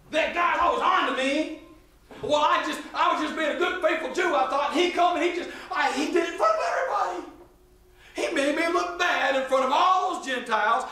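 high-pitched, hammed-up grievance; indignant acted complaint. Peter complaining to Barnabas about Paul's rebuke, mock indignant.

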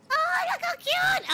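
high-pitched delighted squeal. Real, not acted. Streamer squealing at a cute avatar outfit, delighted. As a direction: squeal it out, way up high over something adorable.

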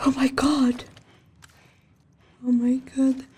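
usual speaking voice

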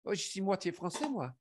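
put-on quick, proud line in a French accent. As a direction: show off the French accent, fast. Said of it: "say it like that 'cause I could get that accent very good".

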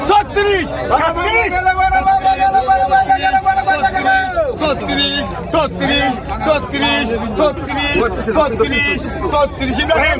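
high, loud chanted auction cries; auctioneer. Real, not acted. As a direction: shout the bids in a sing-song. Fish auction bidding calls.

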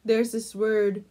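normal talking voice

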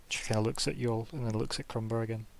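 ordinary talk

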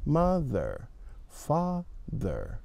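mother, father slow and soft, mouth nearly closed; careful th. Shown as a demonstration. Teacher models th slowly for the class. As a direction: say mother and father slowly with lips almost shut. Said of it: "your mouth isn't opening very much".

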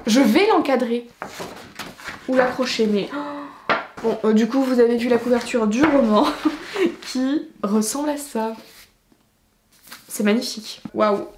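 usual speaking voice

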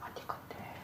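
tense, slow whisper. Real, not acted. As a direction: breathe the words without voice. Tense search for a strange sound.

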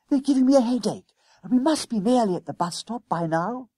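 high, complaining schoolboy voice; Jennings, fed up. A narrator's reading. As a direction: protest like a fed-up boy, pitched high.

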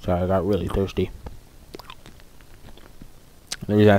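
talking around an ice cube; ice in mouth. Real, not acted. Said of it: "I have ice cube in my mouth".

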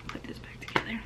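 whispered aside to herself; whispering. Real, not acted. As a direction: breathe it under your breath. Muttering aside while reassembling.

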